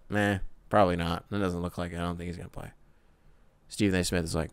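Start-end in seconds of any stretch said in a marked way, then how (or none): 0.1-2.7 soft, lower, deflated meh; lightly voiced disinterested reporter; mock bored mumble. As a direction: shrug it off, barely bothered. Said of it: "I'm being a little hyperbolic in reverse by just saying it in sort of this tone".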